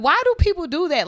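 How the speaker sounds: high and loud exasperation; fed up with the rumor mill; exasperated outrage. Retold or imitated. Fuming to listeners about fake death rumors, high and loud.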